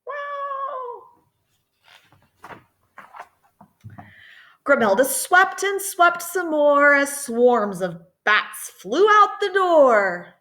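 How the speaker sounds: lilting read-aloud voice; reading to children. A narrator's reading. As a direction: read it like a bedtime picture book. Story narration in rhyme.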